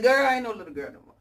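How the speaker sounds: playful indignant clapback; sassy comeback. Retold or imitated. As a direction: snap back with attitude. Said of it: "I be like".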